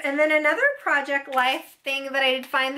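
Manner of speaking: usual speaking voice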